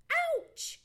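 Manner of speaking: high, loud yelp; pained cry. Acted. Johnny Chuck rolling over on sore wounds, pained cry. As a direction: cry out in sudden pain. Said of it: "cried Johnny Chuck".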